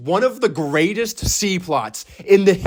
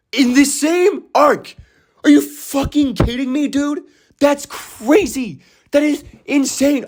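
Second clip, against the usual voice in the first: higher, slow emphatic incredulity; amazed disbelief; 6 semitones higher than usual. Real, not acted. Fan astonished two reveals share one arc, emphatic disbelief. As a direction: stretch the key words, pitched up in amazement.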